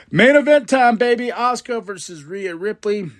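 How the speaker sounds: hyping the main event for viewers; hype call. Real, not acted. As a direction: bellow it like a hype man.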